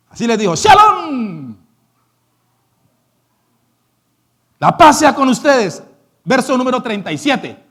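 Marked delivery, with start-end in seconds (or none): 0.5-5.8 loud, ringing blessing; solemn greeting. Acted. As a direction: proclaim peace over them. Jesús saluda a los discípulos. (Jesús bendice con voz fuerte)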